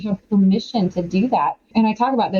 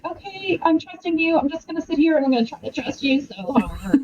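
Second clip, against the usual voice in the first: mock pretending; 5 semitones higher than usual. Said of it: "pretending with God and being like".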